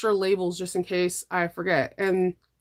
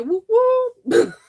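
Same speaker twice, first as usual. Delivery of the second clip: excited whoop; 6 dB louder than usual. Real, not acted. Playful cheer to viewers.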